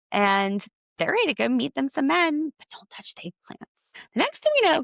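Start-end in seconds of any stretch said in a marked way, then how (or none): 2.6-4.0 breathy stage whisper, conspiratorial; whispered aside. Acted. Podcaster whispering a cheeky aside to listeners. 4.1-4.8 excited build. Real, not acted. Podcaster setting up a twist, excited and loud.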